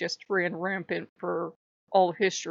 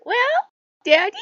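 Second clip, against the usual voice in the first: put-on much higher and louder, sugary child; cute and adorable; 14 semitones higher, 8 dB louder, faster than usual. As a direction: charm daddy like an adorable kid. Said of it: "being all cute and adorable".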